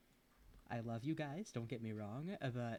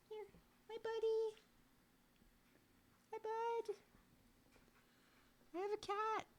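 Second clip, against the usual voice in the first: very high squeaky voice for the cat. Real, not acted. Baby talk to cat.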